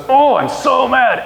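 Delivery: high, slow mock anger; mock angry master. Preacher voicing the master's anger to the congregation, high and slow. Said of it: "He goes".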